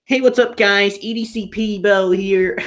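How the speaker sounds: high, loud put-on YouTuber voice; hammed-up channel greeting, stretching the end; mock an over-eager vlogger; parody YouTuber intro. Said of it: "I had to make one E D C P Bo joke".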